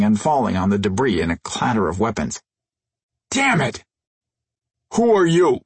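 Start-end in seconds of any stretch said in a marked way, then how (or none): 3.3-3.8 annoyed yelp. Acted. Intruder trips on debris. 4.9-5.6 demanding. Acted. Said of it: "the alien in charge demanded".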